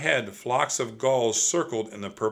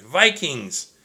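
loud, raised cry of alarm; 4 semitones higher, 7 dB louder than usual. A narrator's reading. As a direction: shout a warning. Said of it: "Jack cried".

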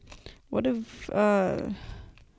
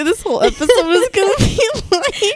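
laughing; 12 semitones higher, 6 dB louder than usual. Real, not acted. Laughing while speaking.

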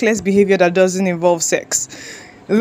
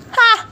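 sharp laugh; 14 semitones higher than usual. Real, not acted. Laughs at own recklessness.

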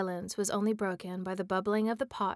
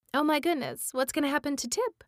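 excited cliffhanger; 6 semitones higher, faster than usual. Real, not acted. Narrator cliffhanger to listeners.

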